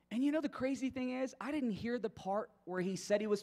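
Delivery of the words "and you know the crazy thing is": ordinary delivery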